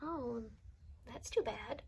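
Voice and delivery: low, soft consoling; sympathetic. Acted. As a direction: offer gentle sympathy to an upset friend. Consoling an upset friend.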